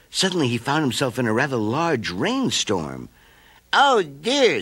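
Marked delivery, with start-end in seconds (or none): 3.7-4.6 high, sighing caterpillar voice. A narrator's reading. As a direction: sigh at the rain. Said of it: "Scooter sighed".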